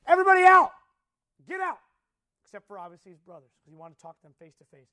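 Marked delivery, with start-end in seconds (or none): 0.1-1.8 loud, high shouted command; crying out. Acted. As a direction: shout to clear the whole room. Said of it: "he just cries out and says".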